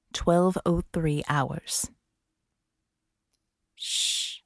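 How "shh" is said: hushing shh. Acted. Hushing partner near a creature.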